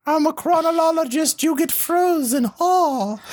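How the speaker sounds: high, slow mock-British voice; terrible British accent. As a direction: overdo a silly British accent. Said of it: "her fucking British in this is terrible".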